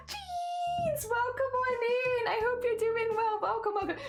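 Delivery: very high, drawn-out welcome squeal; excited greeting. Real, not acted. Streamer welcoming a viewer into chat, squealing excitedly. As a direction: squeal a long, high hello.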